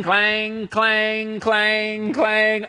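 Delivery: steady, ringing bell imitation; imitated bell clanging. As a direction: chant it like a slow handbell. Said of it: "have to ring that bell".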